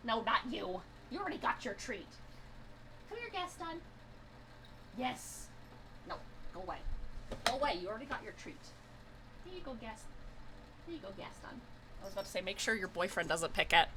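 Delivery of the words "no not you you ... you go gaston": quiet aside to cats. Real, not acted. Shooing one cat, feeding other.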